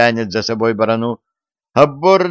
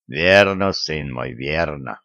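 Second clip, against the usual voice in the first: put-on low old vicar voice; 6 semitones lower, 3 dB quieter than usual. A narrator's reading.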